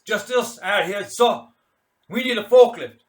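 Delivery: loud, high parade-ground reply; shouted at attention. Acted. Keen answering his commander at attention, loud shout. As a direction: snap it out like a soldier to an officer.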